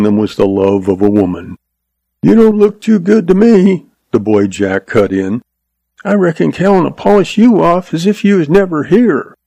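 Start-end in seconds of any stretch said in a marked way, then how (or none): 2.2-9.3 put-on high, scornful kid's voice; a boy sneering at the gunman; cocky boy. A narrator's reading.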